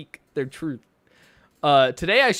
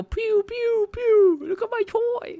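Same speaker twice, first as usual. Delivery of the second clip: very high, put-on playing voice; mock toy lover; slower than usual. Pretending to play with a borrowed toy, mock toy lover. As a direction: squeal toy laser noises in mockery.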